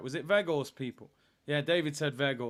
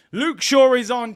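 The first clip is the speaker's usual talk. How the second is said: loud shout; 9 semitones higher, 13 dB louder than usual. Real, not acted. First take, loud shout.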